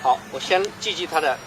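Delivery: usual speaking voice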